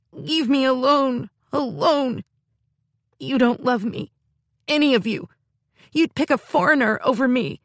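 put-on stamp and howl like a furious child; tantrum. Girl rages at her sister in a childish tantrum. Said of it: "jumped up and down".

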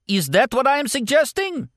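a mad warlord demanding his scientists agree; warlord asking. A narrator's reading.

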